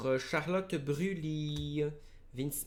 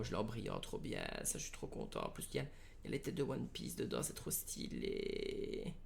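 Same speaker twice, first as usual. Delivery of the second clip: hushed delight; 2 semitones lower, 7 dB quieter, faster than usual. Real, not acted. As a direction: savour a lucky find under your breath. Delighted by shiny favourite card. (ravissement à voix basse)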